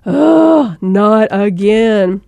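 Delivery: heavy, drawn-out groan of exasperation; oh-no-here-we-go exasperation; exasperated. Acted. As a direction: sigh it out slowly, fed up. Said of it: "choosing exasperation, that feeling of like".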